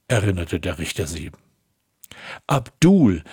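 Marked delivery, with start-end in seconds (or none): 2.4-3.2 narrated motherly cry, louder and higher; mother's cry. A narrator's reading. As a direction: call the name out as a distressed mother, voice lifted. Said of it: "rief sie".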